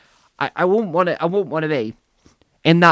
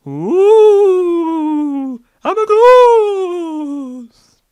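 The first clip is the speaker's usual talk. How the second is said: high, drawn-out wailing "ooh"; hammed-up moaning ghost; spooky ghost voice; 13 semitones higher, 6 dB louder, much slower than usual. Ghost rising from his body, hammed-up wail.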